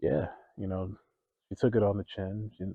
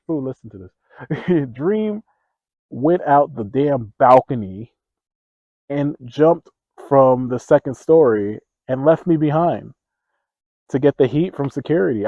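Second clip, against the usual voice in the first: loud, high, drawn-out storytelling outrage; indignant rant; 6 semitones higher, 13 dB louder than usual. Retold or imitated. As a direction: rant at how a friend abandoned you. Indignant rant about friend abandoning him.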